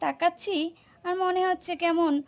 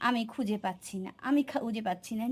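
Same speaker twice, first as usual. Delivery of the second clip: lower, softer whiny mimic of dad; mocking father; 6 semitones lower, 6 dB quieter, faster than usual. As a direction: mumble it helplessly like dad. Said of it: "আর বলো যে".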